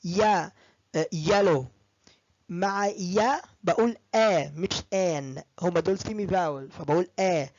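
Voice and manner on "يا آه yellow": يا stressed, then the word, slowly; slow model words. Shown as a demonstration. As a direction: stress يا before each word. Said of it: "كلهم بيبدأوا بيا".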